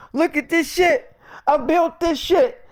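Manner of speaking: high, slow whiny grief; hammed-up mourning over the cars left behind; mock lament. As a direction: wail over the lost work. [name] mourning what he built.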